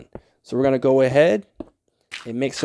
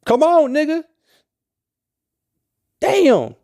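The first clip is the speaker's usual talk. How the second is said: sharp, much higher, louder burst of annoyance; exasperated gamer yelling at his build; frustrated outburst; 13 semitones higher, 5 dB louder than usual. Real, not acted. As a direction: snap at the screen in real frustration.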